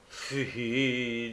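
low, drawn-out scorn; long scornful interjection; disdainful. A narrator's reading. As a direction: dismiss it with contempt. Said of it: "dit le juif avec dédain".

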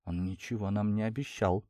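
soft, subdued reply; holding back tears. A narrator's reading. Cousin defending the man, softly near tears. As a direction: say it softly, barely keeping composure. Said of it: "еле сдерживаясь, чтобы не разреветься".

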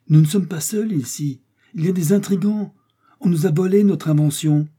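high, loud excited outcry; alarmed astonishment. Acted. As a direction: raise the alarm in amazement. Astonished Joe reports a rival balloon.

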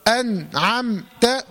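أنعمت broken by silent gaps, "an... am... ta"; wrongful pausing. Shown as a demonstration. Teacher warns against pausing, أنعمت chopped by silences. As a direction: stop silently after the nun and mim of أنعمت. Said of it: "لا تسكت".

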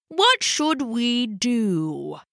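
very slow, drawn-out pondering; slow, thoughtful elder penguin; thinking hard. A narrator's reading. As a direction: stretch each word while puzzling it out. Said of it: "Poppo said, thinking hard".

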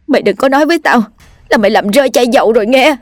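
high, hurried, anxious voice; trembling, nervous. A narrator's reading. Hoa nervously questions Sáu about the lost oil bottle, trembling. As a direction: rush it out high, dreading the answer. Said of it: "bằng một giọng run rẩy".

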